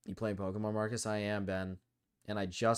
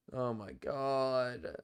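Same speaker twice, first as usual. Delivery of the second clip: very slow, drawn-out groan of words; long-drawn real exasperation; exasperated; 3 semitones higher, much slower than usual. Real, not acted. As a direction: stretch every word out in exasperation. Exasperated after a wrong choice.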